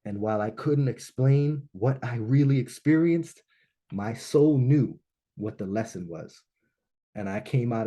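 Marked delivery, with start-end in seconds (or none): none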